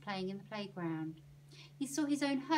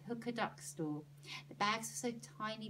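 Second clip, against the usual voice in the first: plain reading voice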